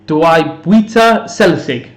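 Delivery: "Dwi bwyta selsig" said slowly, carefully in Welsh; Welsh phrase. Shown as a demonstration. Duolingo learner shows off her Welsh, slow careful. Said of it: "That means".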